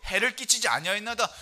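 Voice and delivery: loud, slow proclaiming of the verse; full-force preacher reading Daniel; solemn scripture reading. Acted. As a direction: declaim it like a pulpit reading. Reads Daniel aloud as preacher.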